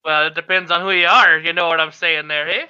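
put-on old shopkeeper lady getting cross; irritated old lady.

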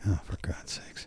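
breathy whispered exasperation; exasperated whisper. Retold or imitated. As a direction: mutter it under your breath, fed up. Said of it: "And I'm like".

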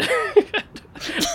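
high burst of laughter; big laugh. Real, not acted. Laughing at own escape.

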